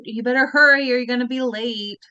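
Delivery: ordinary talk, nothing marked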